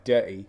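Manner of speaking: "dirty" with the T swallowed, British style; dropped T. Shown as a demonstration. Showing friend the accent, "dirty" without its T. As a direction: say "dirty" leaving out the T sound. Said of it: "they don't really pronounce their T's".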